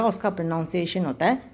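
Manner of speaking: ordinary talk, nothing marked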